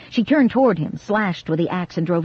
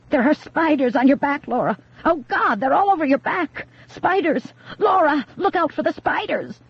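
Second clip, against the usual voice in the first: high, urgent alarm about spiders; alarmed shouting; 9 semitones higher than usual. A narrator's reading. Grace scaring Laura off the axe, high and urgent. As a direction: frighten her with a much higher, urgent warning. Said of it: "Grace shouted again".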